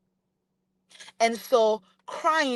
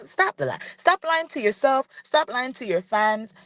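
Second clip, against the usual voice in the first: ordinary talk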